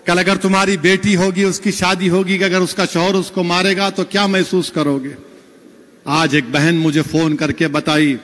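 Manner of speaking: ordinary talk, nothing marked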